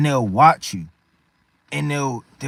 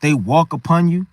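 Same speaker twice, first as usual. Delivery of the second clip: ordinary delivery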